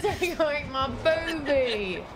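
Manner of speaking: mock Aussie accent. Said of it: "trying to do a London accent. As well as an Aussie".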